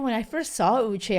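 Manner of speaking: usual speaking voice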